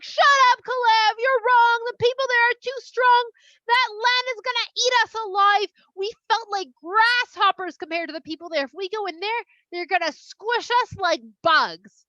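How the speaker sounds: high, loud shouting-down, easing toward the end; arguing spies. Acted. Spies shouting down the one who disagrees, agitated. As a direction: overrule him in hammed-up agitation.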